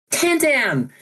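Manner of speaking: high-pitched squeal of the name; excited greeting. Acted. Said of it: "she goes".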